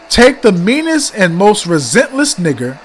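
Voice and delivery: dramatic reading aloud. Shown as a demonstration. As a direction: read each line out like an indictment. Reads the letter aloud.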